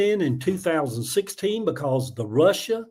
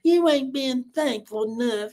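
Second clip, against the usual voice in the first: higher, slow mock scolding voice; mocking Trump's scolding; 7 semitones higher than usual. Streamer mimicking Trump scolding Zelensky, slow and higher. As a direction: sneer it like a petty bully. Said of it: "sit in there on Jimmy Carter's old".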